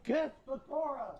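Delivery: high-pitched mock cheer; shrill-pitched imitation of an insistent chat; mock chat demand. Streamer imitating viewers pushing him, mock demanding.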